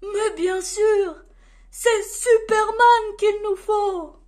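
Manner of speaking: high, loud burst of realisation; triumphant discovery. A narrator's reading. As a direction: proclaim the brilliant idea. Santa finds the solution.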